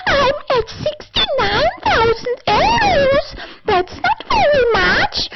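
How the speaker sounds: squeaky falsetto sales pitch; hammed-up high sales shout; high mock voice. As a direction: pitch way up like a cartoon seller.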